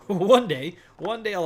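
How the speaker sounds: loud laugh into "One day"; laughing at himself. Real, not acted. Laughs at his unframed window.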